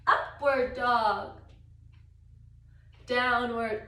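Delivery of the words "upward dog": ordinary talk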